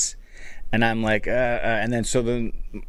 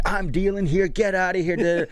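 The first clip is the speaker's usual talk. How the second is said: higher-pitched annoyed broker, retold; mock-angry brush-off, pitched up; mad broker; 5 semitones higher than usual. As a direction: imitate a ticket broker telling someone off. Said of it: "got mad at me".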